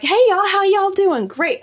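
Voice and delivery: high, loud, friendly Southern greeting; full Southern accent. Retold or imitated. Traveler retelling how she greeted Kentucky locals, full Southern accent. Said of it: "I had full Southern accent".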